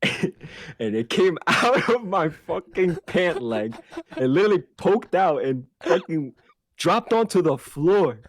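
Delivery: words stretched out through real laughter; can barely get it out for laughing; slow, high, laughing storytelling; laughing while telling. Real, not acted.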